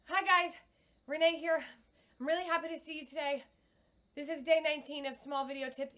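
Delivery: fast nervous greeting with no breath behind it; jittery on-camera hello from the chest; nervous shallow voice. Shown as a demonstration. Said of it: "Instead of".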